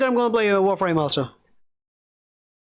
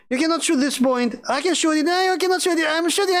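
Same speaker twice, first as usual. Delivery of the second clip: put-on much higher, whiny kid voice; mocking kids; 8 semitones higher than usual. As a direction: mimic kids squabbling over a shot, pitched way up. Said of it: "They were doing".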